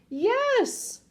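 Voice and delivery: very high-pitched yes; stubborn high holler; yelled insistence. A narrator's reading. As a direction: yell back, pitch shooting up. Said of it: "The man yelled".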